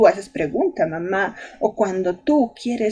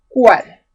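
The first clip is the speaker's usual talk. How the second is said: Cuál punched loud with a falling tune; stress on Cuál; 8 dB louder than usual. Shown as a demonstration. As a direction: hit the accented syllable of Cuál, firmly. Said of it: "Ahí está el acento".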